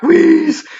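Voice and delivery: high, loud wheezing gasp; high loud wheeze. Acted. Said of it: "gasping for breath".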